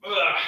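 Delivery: high strained grunt; effort grunt. Real, not acted. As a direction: grunt through the last reps. Grunt during exercise set.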